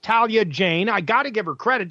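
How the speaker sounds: normal talking voice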